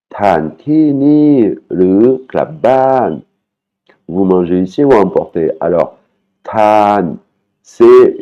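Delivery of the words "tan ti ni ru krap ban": very slow, raised Thai phrase again; slow repeat. Shown as a demonstration. Teacher repeating the vendor's question for learners, slow. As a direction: repeat it syllable by syllable.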